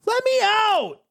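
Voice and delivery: very high angry demand; acted high-pitched outrage; mad yell. A narrator's reading. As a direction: yell it way up high, furious to be freed. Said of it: "Now you're mad".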